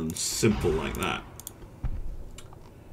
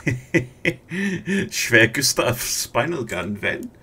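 slow, louder mock German accent; fake German accent; 5 semitones higher, 4 dB louder, much slower than usual. Streamer playfully reading out a viewer's question, fake German accent. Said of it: "said that in a German accent".

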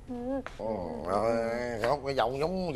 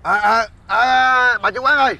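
calling out; 5 semitones higher, 7 dB louder, slower than usual. Acted. Calling out to the shop owner.

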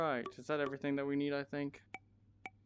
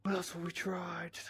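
whispering; 3 semitones higher than usual. Real, not acted. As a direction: murmur it under your breath. Whispering to self in game.

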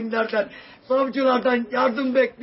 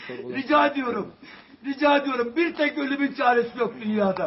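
usual speaking voice

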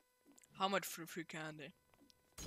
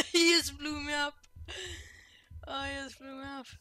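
laughing; 7 semitones higher, 9 dB louder than usual. Real, not acted. Laughing after being blown up.

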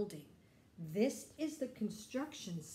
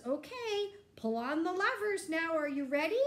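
higher, louder cheery driver; driver's voice; 7 semitones higher, 5 dB louder than usual. Acted. Loader driver urging Alex to pull the levers, bright and loud. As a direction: egg the boy on like a friendly driver. Driver speaks in read-aloud story.

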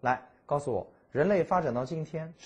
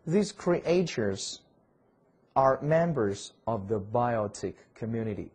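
slow read-aloud; 4 dB louder, slower than usual. Shown as a demonstration. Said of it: "teacher reading English slowly".

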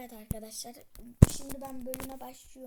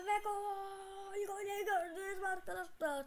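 screaming football commentator; 7 semitones higher than usual. Real, not acted. Goal celebration on stream.

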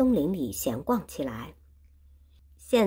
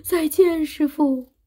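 tearful farewell; 10 semitones higher, 6 dB louder, slower than usual. Acted. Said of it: "哭着".

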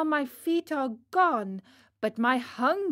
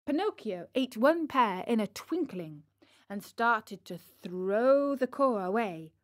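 plain reading voice

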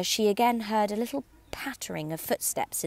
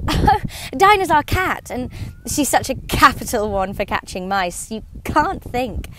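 bright, louder eager gush; 5 semitones higher, 7 dB louder than usual. A narrator's reading. Alice eagerly telling the party about her cat, louder. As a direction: rush to tell about her pet. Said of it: "Alice replied eagerly".

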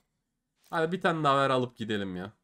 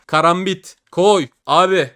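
yelling for the drop; 4 semitones higher, 10 dB louder than usual. Real, not acted. Begging for a knife drop.

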